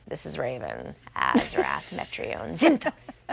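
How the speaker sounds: put-on low, flat, deadpan goth teen voice, with a laugh breaking through; Raven voice. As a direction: drop into a flat, somber dark sorceress. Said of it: "Give us a Raven".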